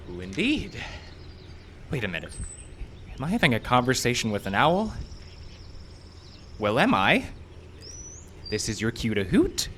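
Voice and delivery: ordinary talk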